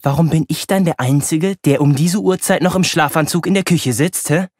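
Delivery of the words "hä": ordinary delivery, nothing marked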